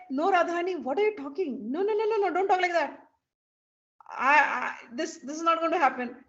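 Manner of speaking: high, loud flustered refusal; perplexed protest. Acted. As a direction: protest in bewilderment, acting it out. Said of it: "she's perplexed".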